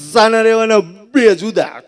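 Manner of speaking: mock crying. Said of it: "રોતા રોતા બોલ્યો કે".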